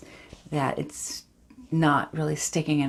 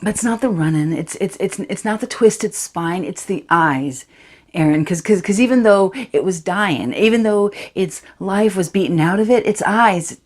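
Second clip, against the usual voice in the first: put-on a distraught fifteen-year-old girl, louder and rising as the memory takes over; Charity, shaken teenager; 5 dB louder than usual. Teen girl confides the fox's death to Aaron, haunted and urgent. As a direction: tell Aaron about the fox, close to breaking. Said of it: "this is Charity from Sam Holcroft's Dancing Bears".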